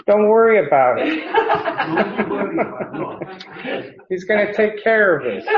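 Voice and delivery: put-on playful Italian accent, laughing; hammy Italian accent, high and loud. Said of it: "in my best Italian accent".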